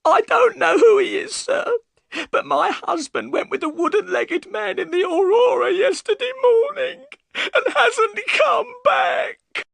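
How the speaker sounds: loud, very high wailing; bursting into tears. A narrator's reading. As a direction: sob it out as a distraught wife. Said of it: "burst into tears".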